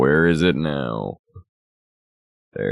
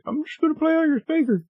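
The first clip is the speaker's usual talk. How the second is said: high mocking voice; faster than usual. Game voiced as taunting.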